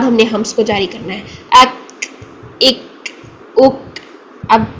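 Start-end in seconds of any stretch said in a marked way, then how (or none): 1.6-3.8 अक इक उक held long then breathed; lock, wait, release; slow locked qaf. Shown as a demonstration. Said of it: "ज़्यादा time इसलिए लगा रही हूँ". (धीरे से आवाज़ लॉक करके)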